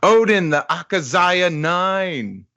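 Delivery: high, slow, drawn-out chorus shout; kids yelling out. Acted. Kids answering their teacher in unison, high mock yell. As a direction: call it out like a class of children. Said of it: "all the kids just yell out".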